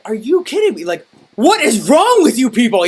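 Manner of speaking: loud, high exasperated outburst; mad. Retold or imitated. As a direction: reenact being fed up with customers. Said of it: "I was so mad about it".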